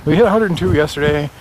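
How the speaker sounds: big, high 'Yes' then quick announcer patter; hammed-up DJ. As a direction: ham up the radio host on purpose. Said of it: "I sound like a radio D J".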